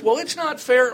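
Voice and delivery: high, loud mock kid whine; whiny child. Father mimicking his child's high, loud whine. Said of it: "what I always".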